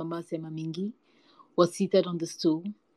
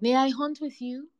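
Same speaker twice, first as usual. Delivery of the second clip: high, eager-to-join question; calling out; 8 semitones higher, 4 dB louder than usual. A narrator's reading. Cat asks hyena to hunt together, calling out. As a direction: call the question out in a raised pitch. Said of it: "he called".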